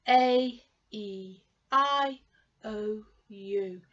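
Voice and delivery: "A, E, I, O, U" each as a long, held vowel name; long vowel sounds. Shown as a demonstration. Teacher drilling the long vowel sounds for learners. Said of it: "And they have the long sound".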